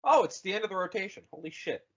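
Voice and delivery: ordinary talk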